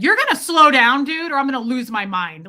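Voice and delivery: loud, high burst easing off; retold fed-up outburst, hardest at the start; angry warning. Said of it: "I was angry".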